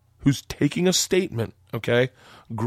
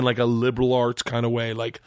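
normal talking voice

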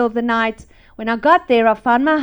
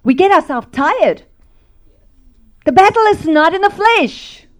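raised, ringing preacher voice; preaching; 6 semitones higher, 3 dB louder than usual. Acted. Preacher telling the church the battle is spiritual, raised preaching. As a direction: preach it to the congregation.

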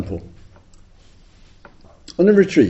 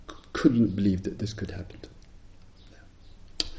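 hushed disbelief; 4 semitones lower, 8 dB quieter than usual. Retold or imitated. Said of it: "she said".

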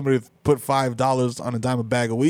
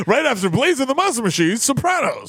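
put-on high, bright kids' channel promo; kids TV announcer; 8 semitones higher than usual. Mock TV announcer.

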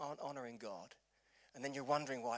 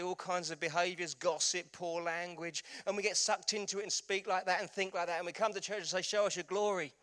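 rising preaching; 7 semitones higher, 8 dB louder than usual. Acted. Pastor to congregation, building preaching force. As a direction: drive it home from the pulpit.

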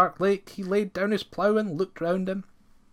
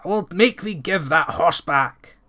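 angry; 2 semitones higher, 6 dB louder than usual. A narrator's reading. Said Ranald in rage.

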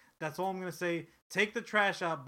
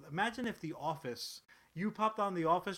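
ordinary talk

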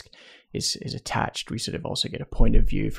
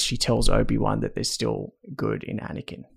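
ordinary talk

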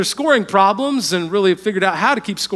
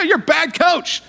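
retold yell at coach; 9 semitones higher than usual. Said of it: "You had to yell at the bad coach".

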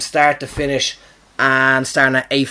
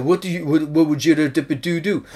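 a lightly put-on French accent; Gerard Depardieu; 2 semitones higher than usual. Comedian trying out his French actor, light French accent. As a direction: stumble through it in mock French accent. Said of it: "That's my French accent".

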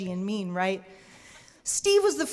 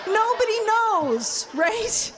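rising alarm; 10 semitones higher, slower than usual. Retold or imitated. Storyteller realizing nobody knows she's eloping, near shout. Building alarm retelling elopement.

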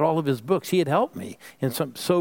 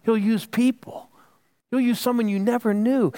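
preaching emphasis; 8 semitones higher than usual. Real, not acted.

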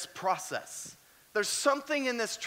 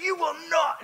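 higher, louder stern command; mock yell; 9 semitones higher, 4 dB louder than usual. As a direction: bark it at the drunk like a tough old medic. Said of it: "she's just yelling at this cat".

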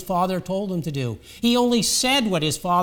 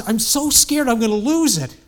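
higher, louder mock panic; worked-up, pitched-up quote; acted young man; 5 semitones higher, 5 dB louder than usual. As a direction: voice an overwhelmed young man blurting it out. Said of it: "the young man came around him".

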